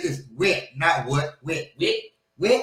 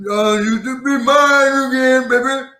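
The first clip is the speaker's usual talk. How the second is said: high, slow, slurred rapper hook; mock the mumble rap delivery; mumbling rapper imitation; 7 semitones higher, 4 dB louder than usual. Imitating a mumble rapper's lazy hook. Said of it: "the mumbling".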